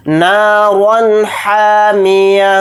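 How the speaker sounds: نارا with a full heavy ra and clear ha in حامیہ; slow chanted demo. Shown as a demonstration. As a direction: thicken the ra of نارا. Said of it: "یہاں پر را کو موٹا پڑھیں گے".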